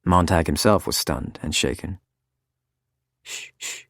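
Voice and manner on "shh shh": soft unvoiced shushing; Mildred hushes Clara in a whisper; hushing whisper. A narrator's reading. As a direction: whisper to calm a crying friend. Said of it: "said Mildred".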